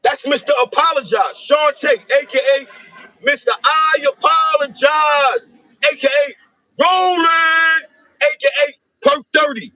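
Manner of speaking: stretched hype-man call; hype intro. Pretend. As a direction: bring him on like a ring announcer. Hype intro of Sean Tate.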